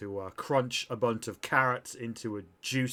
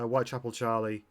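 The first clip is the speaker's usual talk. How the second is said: ordinary talk, nothing marked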